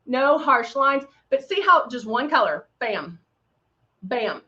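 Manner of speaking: ordinary talk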